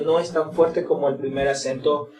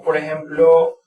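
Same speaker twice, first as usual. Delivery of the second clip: ordinary talk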